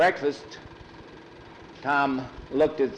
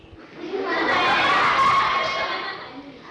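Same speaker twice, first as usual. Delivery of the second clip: laugh; 4 dB louder than usual. Real, not acted. Teller laughs at the name.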